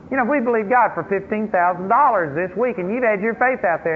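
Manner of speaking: ordinary delivery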